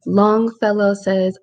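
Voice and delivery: normal talking voice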